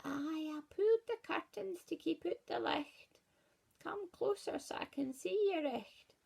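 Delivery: high whispered granny voice; wolf in the bed whispering to lure the girl closer. Acted. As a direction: hush it like a sly old granny in the dark. Wolf disguised in dark bed.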